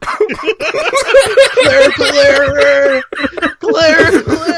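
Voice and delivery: high laughing cries of the name; laughing disbelief. Real, not acted. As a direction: call the name through helpless laughter. Shocked laughing at plot twist.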